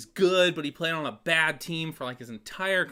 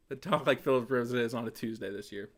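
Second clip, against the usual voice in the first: ordinary talk, nothing marked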